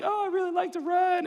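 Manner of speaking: high eager "Oh"; eager fib. Retold or imitated.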